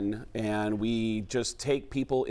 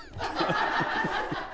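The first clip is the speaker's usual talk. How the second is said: breathy gasp. Shown as a demonstration. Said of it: "that was the sound of your expectations lowering".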